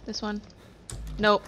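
ordinary talk

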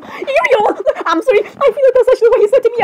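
high, quick flustered protest; alarmed protest. Acted. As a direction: blurt it out high and fast. Alarmed reaction to death threat.

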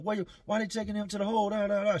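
high, fast, loud protest; mock yelling. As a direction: shout over each other. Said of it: "guys yelling".

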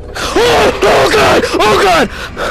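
high loud gasping shout; real fright; startled gamer yelling; frightened cry. Real, not acted. Startled by animatronic.

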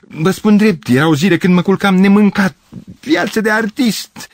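high, theatrical plea; pathetic outpouring. A narrator's reading. Gavrilescu confessing his hungry youth to the girls, pathetic outpouring. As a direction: plead your case with mounting pathos. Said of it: "strigă patetic Gavrilescu".

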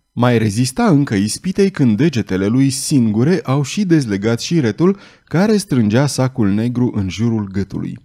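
usual speaking voice

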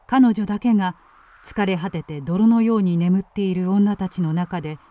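ordinary delivery

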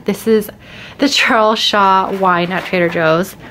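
usual speaking voice

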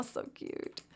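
breathless whisper of delight; whispered aww. Real, not acted. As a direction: whisper "so cute" like you're overwhelmed.

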